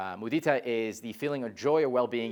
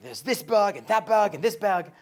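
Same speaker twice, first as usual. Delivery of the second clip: imitating a complaining user; 8 semitones higher, 5 dB louder, slower than usual.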